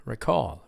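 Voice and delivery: re-CALL, weak start; second-syllable stress. Shown as a demonstration. Teacher repeats the second pronunciation, re-CALL. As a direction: lean on CALL in "Recall". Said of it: "Stressed on the second syllable".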